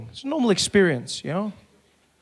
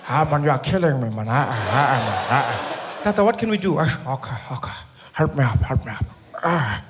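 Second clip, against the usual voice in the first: put-on low, chuckling old man; lightly voiced elder laughing at the joke; a hint of a laughing old statesman; old Mandela voice; 6 semitones lower than usual.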